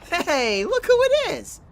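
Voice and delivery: high, loud delighted call; excited greeting. Acted. Friend greeting Mikasa as she arrives, excited. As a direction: shout it out, thrilled to see her.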